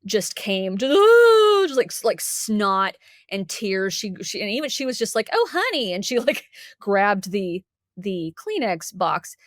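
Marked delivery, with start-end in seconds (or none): 1.0-1.7 loud high mock wail; imitated ugly cry. Student breaking down before counselor, loud imitated wail. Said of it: "ugly cry". 5.3-5.9 high-pitched, cooing sympathy; sympathetic counselor. Retold or imitated. Counselor to crying student, high pitying coo.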